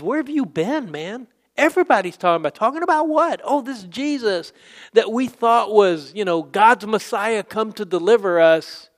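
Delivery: high, animated mock disbelief; incredulous. Acts travellers' astonished exchange with Jesus.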